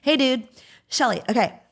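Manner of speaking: lightly voiced laid-back friend; a hint of a cool older girl calling out. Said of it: "this is a [name] impersonation".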